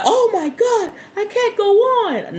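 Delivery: high, laughing mock distress, slowing down; giggling fake breakdown; mock overwhelmed. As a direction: play at being too scared to go on. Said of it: "I'd be like".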